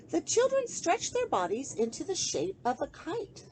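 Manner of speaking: put-on rhyming picture book read aloud to children; lilting sing-song storybook reading; story narration. A narrator's reading. Host reading a bedtime book to kids, gentle storytelling.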